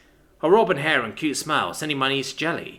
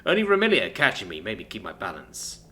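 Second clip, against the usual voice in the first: plain reading voice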